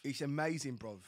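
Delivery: a hint of Cockney echo; mock Cockney. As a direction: echo him in light Cockney. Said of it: "worst accent".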